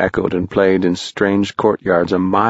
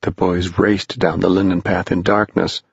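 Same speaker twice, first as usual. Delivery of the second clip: ordinary delivery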